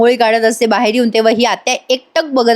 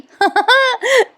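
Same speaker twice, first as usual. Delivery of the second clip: a quick high-pitched giggle; high laugh; 13 semitones higher than usual. Real, not acted. Laughing at the aunt who keeps peering in. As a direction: let a short high laugh escape.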